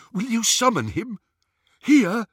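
high, fearful questioning; awed and terrified. A narrator's reading. Scabier to Greyback, high and frightened. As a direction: ask it in awed dread. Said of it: "sounding awed, terrified".

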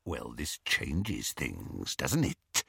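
low, slow, hushed werewolf; menacing whisper. A narrator's reading. Greyback to the captives, low hushed menace. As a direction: breathe it out with relish. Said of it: "whispered Greyback".

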